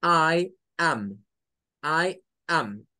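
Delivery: I am with a Spanish open a; old classroom I am; Latin-style I am. Shown as a demonstration. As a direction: say I am with a plain Spanish a in am. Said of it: "le acostumbraron a decir".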